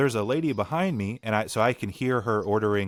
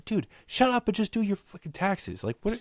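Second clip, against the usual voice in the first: exasperated rant; 11 semitones higher than usual. Real, not acted. Ranting at tax commercial.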